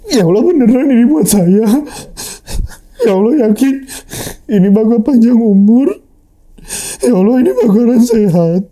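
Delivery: praying through real tears; tearful prayer. Real, not acted. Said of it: "Terharu nih jadi".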